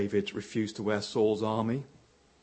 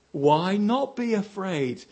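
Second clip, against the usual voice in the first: louder, slow and pointed; emphatic question; 6 semitones higher, 6 dB louder, slower than usual. Real, not acted. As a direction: lean on NOT, drawn out. Rhetorical challenge, slowed and loud.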